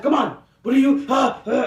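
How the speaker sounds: hammed-up impatient dentist. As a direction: mimic the pushy dentist rushing her. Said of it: "he's just like".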